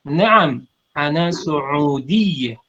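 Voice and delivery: "Saudiyah" said very slowly with a held doubled consonant. Shown as a demonstration. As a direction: stretch "ana Saudiyah" to show the shadda. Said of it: "Yang ditasjidnya itu adalah huruf ya". ("Saudiyah" diucapkan pelan dengan tasydid jelas)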